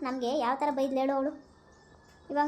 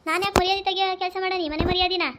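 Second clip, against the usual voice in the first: higher and louder, frightened protest; panicked denial; 6 semitones higher, 4 dB louder than usual. Acted. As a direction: deny it in a scared rush. Daughter caught, denies to mother.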